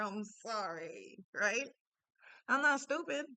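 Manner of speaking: ordinary delivery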